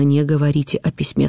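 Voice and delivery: ordinary delivery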